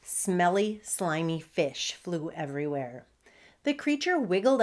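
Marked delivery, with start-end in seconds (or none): none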